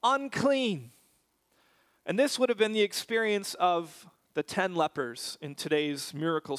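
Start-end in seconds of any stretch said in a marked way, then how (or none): 0.0-0.9 mock shout. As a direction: call it out as an outcast's warning, restrained. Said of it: "all that they could shout".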